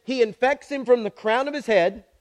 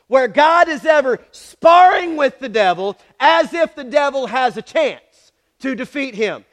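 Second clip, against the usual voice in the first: ordinary delivery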